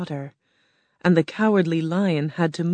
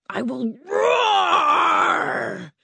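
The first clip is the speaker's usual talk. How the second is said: high, drawn-out shouted roar; hammed-up roaring boast; mock roar; 12 semitones higher, much slower than usual. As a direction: stretch the word out into a big roar. Lion boasts he'll roar.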